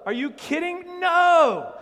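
high shouted no, are you kidding; incredulous shout. Real, not acted. Preacher to congregation, shouting high in disbelief. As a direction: throw it back at them in disbelief.

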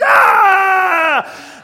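huge high roaring cheer; crowd roar. Retold or imitated. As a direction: erupt like a packed arena after a great shot. Packed arena crowd erupts.

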